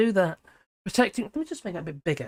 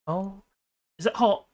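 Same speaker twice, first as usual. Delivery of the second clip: ordinary talk, nothing marked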